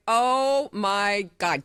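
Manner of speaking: slow, drawn-out amazement; awed reaction. Retold or imitated. Wife seeing the ring he bought, slowly awestruck. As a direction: stretch out every word in awe.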